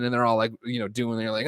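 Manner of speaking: ordinary talk, nothing marked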